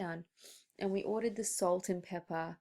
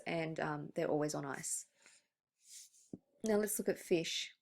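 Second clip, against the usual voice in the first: ordinary talk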